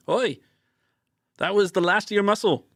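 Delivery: high, loud put-on Aussie twang; terrible Australian accent. Kit calls out to Grizzle over the wind in a bad Australian accent. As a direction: play a tough Aussie calling over the wind. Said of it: "This is a terrible accent".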